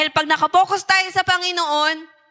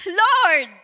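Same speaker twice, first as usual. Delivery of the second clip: calling out; 4 semitones higher, 4 dB louder than usual. Acted. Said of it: "Tinawag niya si Jesus".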